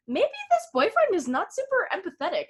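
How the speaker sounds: high, swooping musing tone; doubtful musing. Acted. Acting out a viewer's doubtful thought.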